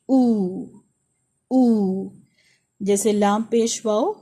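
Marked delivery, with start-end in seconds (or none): 0.1-2.1 او as a long round oo; او held as a long vowel; long oo demo. Shown as a demonstration. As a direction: round the lips for او. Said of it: "واؤ کی جو sound ہے یہ English letter".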